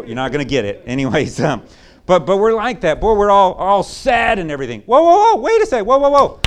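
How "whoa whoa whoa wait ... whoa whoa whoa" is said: high, loud sudden stop; startled whoa. Real, not acted. Preacher stopping the congregation's gloom, high and loud. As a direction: pull everyone up short. Preacher halting the sad attitude.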